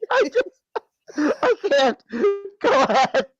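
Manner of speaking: high, cracking up mid-sentence; helpless laughter. Real, not acted. Co-host losing it over a song joke, helpless laughter. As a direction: laugh so hard you can't go on. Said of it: "I'm done".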